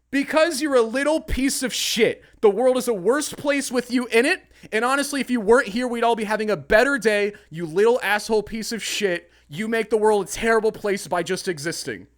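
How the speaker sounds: loud, high furious rant; retold tirade. Telling the teen off from the car window, loud and furious. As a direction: let the kid have it, loud.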